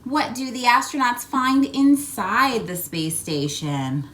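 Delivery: usual speaking voice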